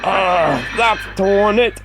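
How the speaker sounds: a groaning driver's voice, drawn out; dismayed driver. Acted. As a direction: driver seeing the bent snowplow, rueful and slow. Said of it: "said Thomas's driver".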